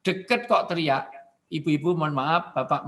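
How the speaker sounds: ordinary talk, nothing marked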